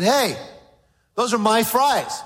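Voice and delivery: lightly voiced boy claiming his fries; eight-year-old boy. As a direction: play a possessive young son. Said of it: "About an eight-year-old boy".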